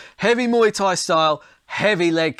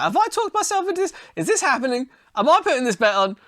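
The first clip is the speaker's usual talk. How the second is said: higher-pitched, playful self-questioning; mock disbelief; 7 semitones higher, faster than usual. Bettor questioning himself over a long-shot bet, in mock disbelief. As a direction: act amazed at your own change of mind.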